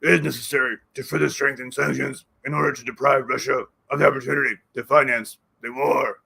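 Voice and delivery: lightly put-on foreign voice, formal; Zelensky impression. Zelensky addressing the Treasury Secretary, lightly mimicked. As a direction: read it like a stiff foreign leader, mildly voiced. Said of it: "as he would say".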